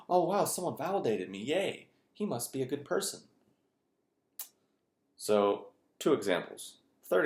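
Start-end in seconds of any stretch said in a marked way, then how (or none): 0.1-3.2 mock delight. Woman mocked for flattery.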